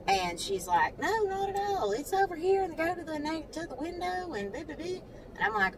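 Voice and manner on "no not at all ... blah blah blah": high, soft sugary bank teller; high, soft imitation of a sweet teller. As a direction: mimic the overly nice teller, lifted high. Teller voiced, high sweet reply.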